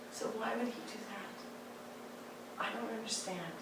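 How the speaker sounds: barely voiced, lost and confused; small hurt child voice. As eight-year-old [name] telling her mother, quiet and bewildered.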